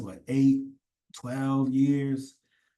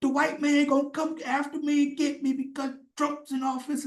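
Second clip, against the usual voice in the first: much higher, mocking voice of a frightened man; mocking whiny voice; 12 semitones higher than usual. Mocking soy boys crying online, higher whiny voice. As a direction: whine it out like a scared online guy. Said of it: "Not this".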